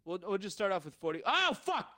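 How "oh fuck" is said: frustrated outburst. Real, not acted.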